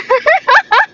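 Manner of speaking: loud high laugh; high burst of laughter. Real, not acted. Podcaster laughing at her own hallelujah.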